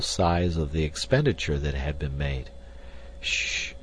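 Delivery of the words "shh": soft, voiceless shushing; cautioning hush. A narrator's reading. Clayton warning Jane about her father, hushing. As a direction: hush her quickly and softly. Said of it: "cautioned Clayton".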